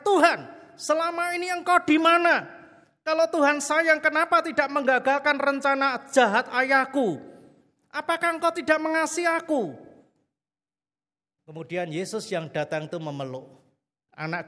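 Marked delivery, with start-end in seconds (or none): 0.0-9.8 high, loud accusation hurled at God; furious accusing cry. Retold or imitated. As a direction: demand why He did not stop it. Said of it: "ditendang dengan mengatakan". (teriakan marah yang menuduh, tinggi dan keras)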